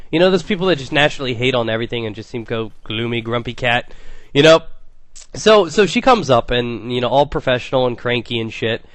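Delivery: usual speaking voice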